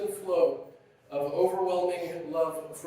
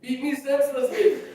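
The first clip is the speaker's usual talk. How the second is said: higher mock yell with a laugh; retold child's yell; 8 semitones higher, 3 dB louder than usual. Little boy calling to father home from work, mock yell. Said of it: "I would yell out".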